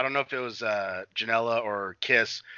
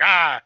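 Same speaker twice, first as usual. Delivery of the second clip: imitated grunt; 3 semitones higher, 8 dB louder than usual. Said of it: "like if you're doing".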